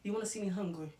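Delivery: ordinary talk, nothing marked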